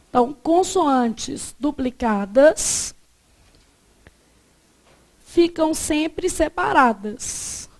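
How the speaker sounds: slow dictation. Shown as a demonstration. Teacher dictates rule to copy.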